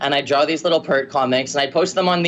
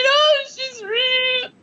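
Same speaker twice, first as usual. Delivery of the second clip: very high squeal trailing into a sob; mock tearful wail; much slower than usual. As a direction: imitate yourself crying, overwhelmed. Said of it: "pictures of me crying".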